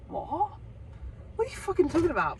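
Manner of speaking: high, fast incredulous outburst; can't believe what she's hearing; real disbelief. Real, not acted. Telling viewers about Finn's cinema claim, disbelieving.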